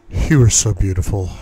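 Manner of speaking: ordinary delivery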